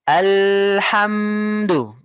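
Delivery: alhamdu with the voice held unbroken through hm, slow; flowing alhamdu. Shown as a demonstration. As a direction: let alhamdu flow without a stop. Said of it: "suaranya itu tidak putus ya". (alhamdu mengalir, tidak putus)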